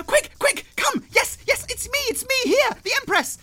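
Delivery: high, loud shouts through a door; frantic, high-pitched summons in character; urgent calling out. Acted. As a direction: yell excitedly for someone to come quickly. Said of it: "then she bangs on it".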